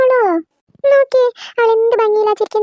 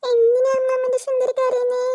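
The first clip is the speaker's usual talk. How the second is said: higher, softer doting grandmother voice; grandma comforting the crying baby, softly and higher; grandma soothing; 5 semitones higher, 4 dB quieter than usual. Acted.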